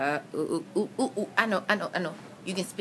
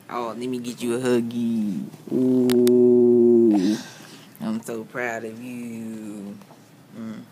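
lower, slow and tender; 5 semitones lower, 5 dB louder, much slower than usual. Real, not acted. Comforting patient after surgery.

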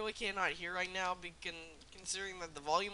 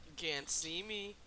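ordinary talk, nothing marked

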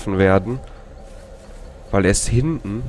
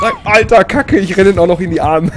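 high, loud outburst; dismayed yell; 9 semitones higher, 6 dB louder than usual. Real, not acted. Player running into the killer's arms, dismayed yell. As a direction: yell it in disbelief at your blunder.

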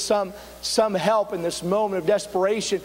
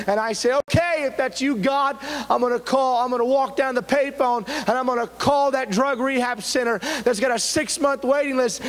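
driving testimony in a raised preacher's voice; impassioned preaching; 5 semitones higher than usual. Acted. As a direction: preach the story with mounting urgency. Preaches a testimony at full force.

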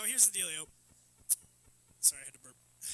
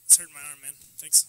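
ordinary delivery

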